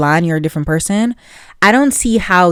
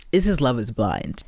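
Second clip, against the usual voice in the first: ordinary talk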